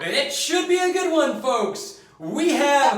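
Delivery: loud, high announcer hype; sportscaster voice. Acted. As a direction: call it like a ringside announcer. Mock sportscaster intro.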